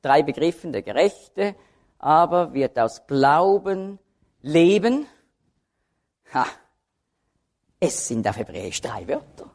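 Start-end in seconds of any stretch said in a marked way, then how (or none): 0.7-5.1 Gerechte, Glauben, leben set apart with pauses; three stressed terms. Shown as a demonstration. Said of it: "die Akzente liegen auf den drei Begriffen". (drei betonte Begriffe mit Pausen)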